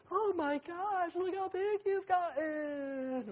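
high, slow doting auntie voice; drawn-out high gush of a relative. Retold or imitated. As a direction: coo over the kids like a visiting aunt. Visiting relatives gush over kids.

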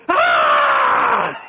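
startled cry of horror at the wrong text; loud panicked shout at the phone; panicked yelp. Retold or imitated. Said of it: "and I go, I go".

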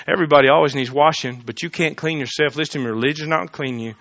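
usual speaking voice

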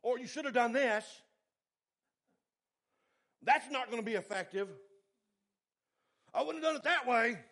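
nagging boss hammering on a rookie; angry supervisor. Retold or imitated. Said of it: "like he was an angry old bull".

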